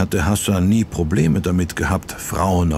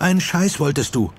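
a touch of an angry interruption, higher; mild cutting off; 8 semitones higher than usual. A narrator's reading. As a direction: snap at him, lightly voiced. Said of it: "fuhr sie ihm über den Mund". (leicht angedeutetes Anfahren)

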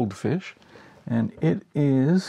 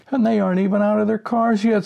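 high, fast, loud squeal of glee; hysterical chairman; 11 semitones higher, 5 dB louder, faster than usual. Acted. Chairman screaming with laughter to the other directors, high and fast. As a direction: scream it out through helpless laughter. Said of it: "screams the chairman".

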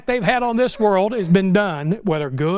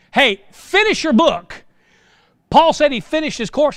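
emphatic preaching; 7 semitones higher, 4 dB louder than usual. Real, not acted. Preaching climax.

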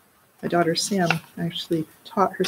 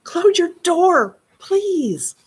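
annoyed sister; 11 semitones higher, much slower than usual. A narrator's reading. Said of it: "yelled Sister Kate".

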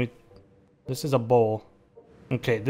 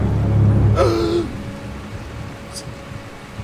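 long shocked gasp, much higher; real startled gasp; loud gasp; 3 dB louder than usual. Real, not acted. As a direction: gasp in amazement. Reacts in game.

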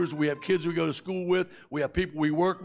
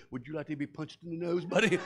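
mock threat; 4 dB quieter than usual. Dad threatening the ride attendant, quiet menace. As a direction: growl it at the little guy.

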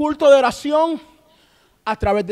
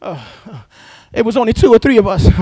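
ordinary talk, nothing marked

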